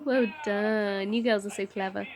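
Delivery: normal talking voice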